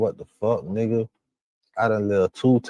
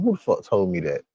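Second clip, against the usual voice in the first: ordinary talk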